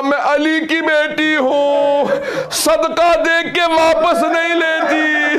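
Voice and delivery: high, very slow, weeping declaration; tearful proud reply. Acted. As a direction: hold back sobs while standing firm. Zainab refuses to take back heads.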